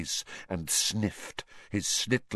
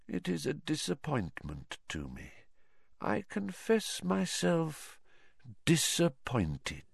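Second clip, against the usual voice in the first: quiet, menacing reproach; hushed disappointment; 6 semitones higher, 10 dB quieter than usual. Acted. Villain voices cold disappointment.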